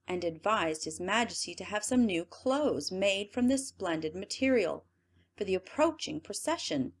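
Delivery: plain reading voice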